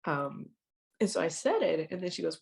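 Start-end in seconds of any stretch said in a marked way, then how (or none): none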